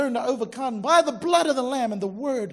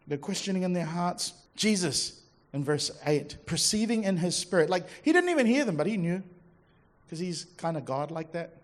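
normal talking voice

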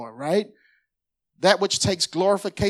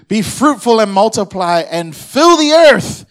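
loud, slow, higher proclaiming of the verse; 4 semitones higher, 11 dB louder than usual. Acted. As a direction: thunder the verse to the church. Preaching at full force.

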